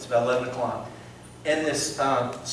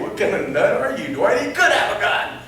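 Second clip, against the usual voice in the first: mock alarm; 9 semitones higher, 4 dB louder than usual. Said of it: "And I was thinking".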